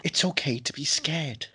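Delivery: soft, slow mock Dolittle line; lightly voiced Welsh-ish doctor, quiet and slow; a hint of a soothing film doctor, hushed; Robert Downey Junior impression. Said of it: "quote ... Robert Downey Junior in Dolittle".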